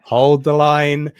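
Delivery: loud, high battle cry, long drawn-out words; rallying movie war-hero shout; Braveheart. Acted. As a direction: bellow to the troops to hold firm. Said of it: "do my best Braveheart impression".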